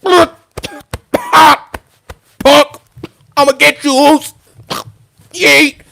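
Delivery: hammed-up wrestler callout; mocking Jey Uso. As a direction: bark it like a cocky wrestler calling out a rival. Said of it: "proceeds to go like".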